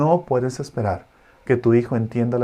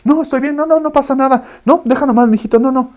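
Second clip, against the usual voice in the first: high, loud flustered denial; parent hiding tears; 11 semitones higher, 7 dB louder than usual. Retold or imitated. Parent denying tears to the worried child, high and loud. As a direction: brush it off, no, no, high and hurried. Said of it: "Cuando tu hijo llegue y te diga".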